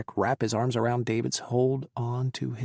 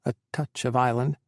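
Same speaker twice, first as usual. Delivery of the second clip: plain reading voice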